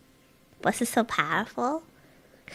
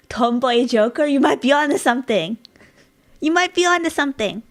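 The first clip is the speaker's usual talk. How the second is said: loud, raised, delighted realization; excited; 5 semitones higher, 6 dB louder than usual. Real, not acted. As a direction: burst out as if struck by genius. Excited reaction to the joke idea.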